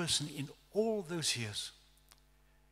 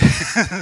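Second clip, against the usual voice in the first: mock nervous laugh; 6 semitones higher, 13 dB louder than usual. Laughing at own trap.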